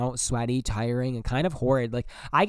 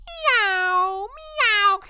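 high, loud squeaky cat meows; mock cat meow; 4 dB louder than usual. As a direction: meow like a silly kitten, way up high. Mocking the kitten pet name.

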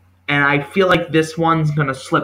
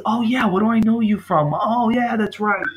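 sudden recognition; 7 semitones higher than usual. Retold or imitated. Reviewer mimicking a viewer who recognizes the actor, high mock recognition. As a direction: voice jumps up high in a mock aha moment.

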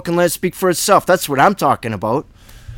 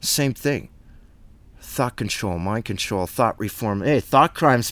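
ordinary talk

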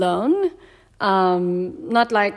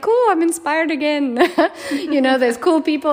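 excited; 9 semitones higher, 7 dB louder than usual. Retold or imitated. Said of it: "I was like".

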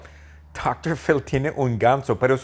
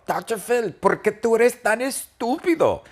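much higher mocking squeak; taunting Tom; 12 semitones higher than usual. Tom taunting doctor Phil, high bratty squeak.

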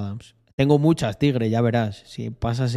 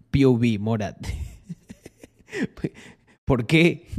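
usual speaking voice

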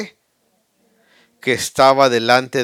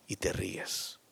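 hushed; 6 semitones lower, 20 dB quieter, slower than usual. Acted. Preacher hushes on mockery.

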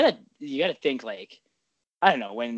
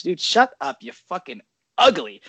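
louder, higher taunting bark; mock chirp yell; 4 semitones higher, 8 dB louder than usual. Demonstrating a simple chirp, louder taunting yell. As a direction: throw the insult across the ice. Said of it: "Just a straight up".

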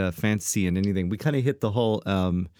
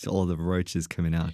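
normal talking voice

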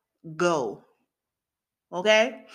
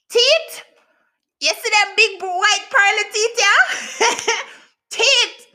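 high loud laughing shout about teeth; 10 semitones higher, 9 dB louder than usual. Real, not acted. Telling viewers her joy, shouting through laughter. Showing off her big grin.

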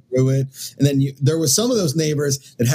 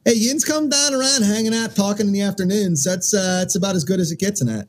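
higher, hammed-up Pittsburghese; Pittsburgh accent; 4 semitones higher than usual. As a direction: play a chatty Pittsburgh local. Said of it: "that's from Pittsburgh".

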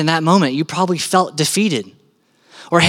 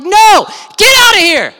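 high, loud shout of dismissal; forceful, drawn-out yell; commanding shout; 13 semitones higher, 7 dB louder than usual. Acted. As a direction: throw them out of the house. Jesus throws mourners out.

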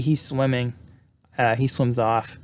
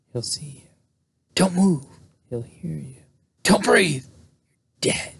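spooky storyteller. Retold or imitated. Retelling the campfire ghost story.

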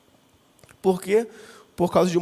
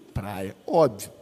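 deadpan punchline; 11 semitones lower, 4 dB quieter, much slower than usual. Real, not acted. Punchline of his own story.

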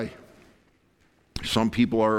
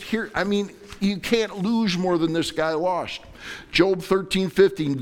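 usual speaking voice

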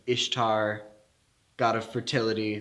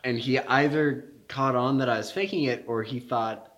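usual speaking voice

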